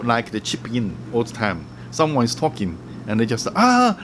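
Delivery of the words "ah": high, sudden "ah" butting in; mock interrupting. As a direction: imitate someone chipping in. Acting someone butting into talk.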